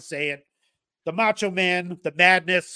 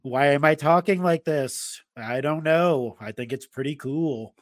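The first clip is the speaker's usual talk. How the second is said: dragged-out words. Real, not acted. Host greeting listeners, playfully dragging out the words. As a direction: stretch the words out like an announcer. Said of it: "Just dragging out the, uh, words there".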